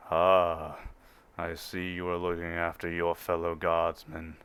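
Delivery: low, slow and soft, a towering officer; imposing Imperial officer. Acted. Officer praises the guardsman tending her comrade, low and slow. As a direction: speak down from seven feet tall, unhurried.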